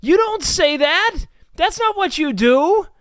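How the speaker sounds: high, loud, slow outraged scolding; mock outraged. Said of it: "I was like".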